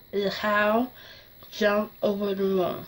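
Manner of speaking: slow, slurred speech from a fresh tongue piercing. Shown as a demonstration. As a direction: speak around a swollen pierced tongue. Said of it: "think that weird slur".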